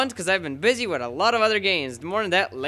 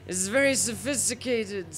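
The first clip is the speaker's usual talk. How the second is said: put-on James Mason English accent; 4 semitones higher than usual. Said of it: "speaks in this James Masony English accent".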